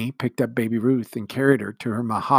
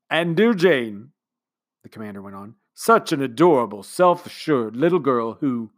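commander's speech; 2 semitones higher, 6 dB louder than usual. A narrator's reading. Commander speechifying to the household, sentimental. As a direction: speak like a sentimental host.

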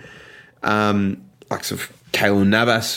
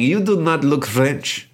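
higher, slow mock French accent; terrible French accent; 5 semitones higher, slower than usual. Imitating French bar-goers sizing him up, terrible French accent. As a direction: put on a bad French accent. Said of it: "that's my terrible French accent".

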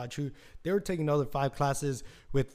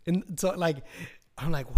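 ordinary delivery

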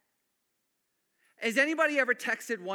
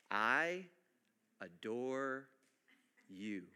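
soft, slow, tender "I adore you"; tender whisper; 10 semitones lower, 7 dB quieter, much slower than usual. Acted. Preacher softly voicing Jesus's message to the church. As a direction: breathe it like a love note.

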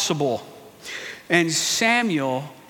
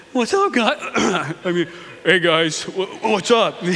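put-on awkward teenage boy. Awkward teen David voiced.

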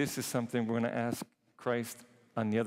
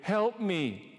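pleading blind man; 8 semitones higher, 3 dB louder than usual. Acted. Paralytic begging Christ.

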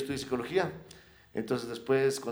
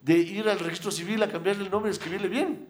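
higher, louder, keen pitch of an idea; eager proposal; 7 semitones higher, 4 dB louder than usual. Retold or imitated. Guest retelling his offer to a fan, eagerly. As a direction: retell your own over-eager suggestion, raised voice. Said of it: "le explico todo esto y digo".

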